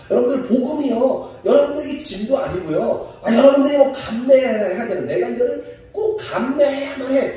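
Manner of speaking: big, emphatic pulpit delivery; full-force preaching. Real, not acted. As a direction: declare it to the whole congregation, loudly.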